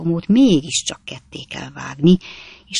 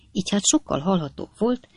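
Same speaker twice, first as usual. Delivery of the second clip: ordinary delivery, nothing marked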